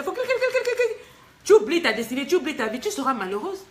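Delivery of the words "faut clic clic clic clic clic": very high, rattled-off clicks; squeaky imitated clicking. Host mocking money dazzling a woman's eyes, squeaky clicking.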